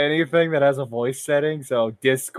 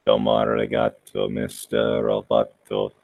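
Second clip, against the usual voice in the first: very low robotic voice; 4 dB quieter than usual. Acted. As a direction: intone it like a robot. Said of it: "malfunctioning robots".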